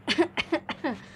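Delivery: a pointed, put-on cough; fake cough. Coughing on purpose at the boys blowing smoke at her. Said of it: "पहले थोड़ा सा खांसा".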